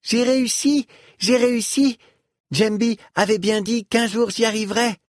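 put-on high-pitched triumphant child; thrilled little voice, very high; excited cry. A narrator's reading. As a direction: cry out like a child who just succeeded. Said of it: "Phalom s'écriait".

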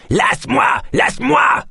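high, insistent shriek; yelling. A narrator's reading. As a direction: howl to be released. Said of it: "et se mit à hurler". (hurlements aigus et répétés)

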